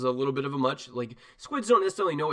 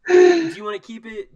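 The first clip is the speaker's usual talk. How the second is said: higher, louder half-sobbed ask; mock-teary question; 13 dB louder than usual. GM asking the player whether to keep the squid, mock-wailing. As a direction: blurt it in a mock wail. GM exclaims, crying voice.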